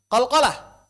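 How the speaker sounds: sharp emphatic burst on "qalqalah"; punched key term. Shown as a demonstration. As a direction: hit "qalqalah" hard as the forbidden thing.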